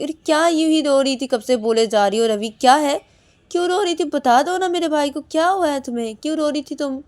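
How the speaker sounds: loud, high-pitched interrogation; scolding demand. Acted. Sister scolds and demands answer. (डाँटते हुए ऊँची आवाज़ में सवाल)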